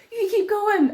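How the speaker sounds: high-pitched imitation of urging on a runner; retold urging. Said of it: "I literally screamed at, he was like".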